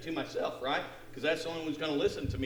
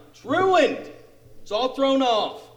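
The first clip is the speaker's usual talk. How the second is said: higher, louder burst of frustration; exasperated complaint; 11 semitones higher, 11 dB louder, slower than usual. Retold or imitated. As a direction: cry out over spoiled plans. Acts out his spoiled day.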